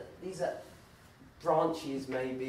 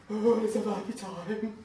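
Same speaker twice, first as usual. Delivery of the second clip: high, put-on happy family voice, half laughing; mock sugary family jollity, pitched up; forced cheer; 7 semitones higher, 6 dB louder than usual.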